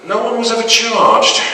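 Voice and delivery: ordinary delivery, nothing marked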